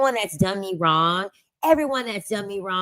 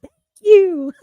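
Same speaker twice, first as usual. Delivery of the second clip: gushing thanks; 11 semitones higher, 6 dB louder than usual. Retold or imitated. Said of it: "And I'm like".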